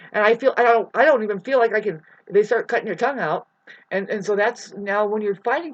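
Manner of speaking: loud, fast, worked-up rant; heated outburst. Real, not acted. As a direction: let it burst out, building heat. Heated rant about free speech.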